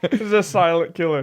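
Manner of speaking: laughing while talking; chuckling through the words. Real, not acted. Joking, laughing.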